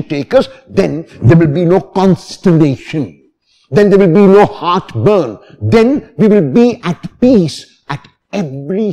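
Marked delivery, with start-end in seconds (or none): none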